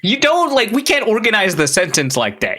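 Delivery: incredulous protest. Real, not acted. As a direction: protest in high disbelief. Guest objects to host's phrasing.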